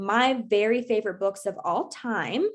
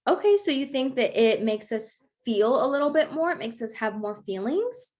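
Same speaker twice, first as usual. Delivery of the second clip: usual speaking voice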